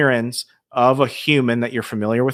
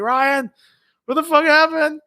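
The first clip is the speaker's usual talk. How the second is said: very high re-enacted panicked call; hammed-up high-pitched alarm; 3 dB louder than usual. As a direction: cry out after a partner who bolted. Frozen, calling after fleeing partner.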